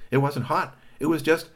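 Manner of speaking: excited narration. A narrator's reading. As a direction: build it up to a squeal.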